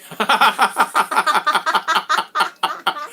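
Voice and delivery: a long, high burst of laughter; hearty laugh. Real, not acted. Host laughing with his guest on stream, hearty laughter. Laughs at empty phone app.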